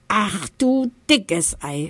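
slow, drawn-out amazement; amazed. Acted. Eddie Bär discovers all his friends on the train, amazed. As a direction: marvel at it slowly. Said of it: "staunt Eddie Bär".

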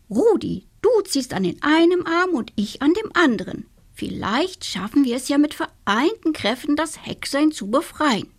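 the clever hen pitching her idea; eager hen. Acted. Hen Agathe proposes plan. (eifrige Henne, höhere Stimme)

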